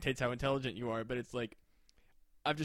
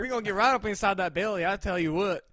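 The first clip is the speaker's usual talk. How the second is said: higher, louder mock Southern surgeon; thick Alabama accent; 5 semitones higher, 8 dB louder than usual. Scene: surgeon about to open up the belly, thick Alabama accent. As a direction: join in as a folksy Alabama surgeon. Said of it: "She had a really thick Alabama accent".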